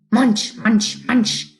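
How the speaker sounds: chewing noises in a creature voice; munch like a busy bug; munching beetle. Acted. Beetle chewing leaves in the bushes, playful creature voice.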